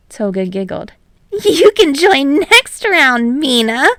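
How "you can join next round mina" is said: put-on high girlish voice. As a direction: coo it sweetly. Toga high voice.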